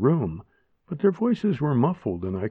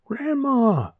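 put-on high frightened child scream; 14 semitones higher than usual. Frightened girl screams for grandma, high. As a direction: scream for help. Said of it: "I screamed".

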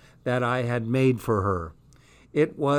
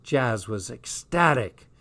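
usual speaking voice

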